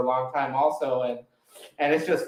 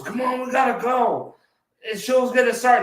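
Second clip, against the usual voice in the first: imitating friend; 9 semitones higher, 5 dB louder than usual. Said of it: "and Miles goes".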